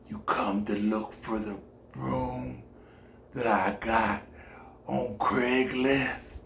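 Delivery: put-on slow, low, soft breathy old man whisper; whispery old man. The old landlord questioning LaDon, a slow eerie whisper. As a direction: creep out the visitor.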